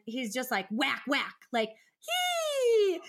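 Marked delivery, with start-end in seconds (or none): 2.0-3.0 put-on high squeaky kid noise; toddler's excited squeal; son's squeal. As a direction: squeal like a toddler playing rough. Son's playful squeal at dog.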